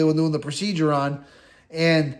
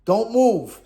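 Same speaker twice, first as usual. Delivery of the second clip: higher, mock-urgent police order; cop's command; 4 semitones higher, slower than usual. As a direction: bark it like a cop holding a gun. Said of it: "He goes".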